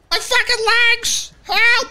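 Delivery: high, loud drawn-out yell; hammed-up, high-pitched scream of pain; screaming in mock agony. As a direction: pretend wounded victim screaming for help. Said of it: "somebody's screaming".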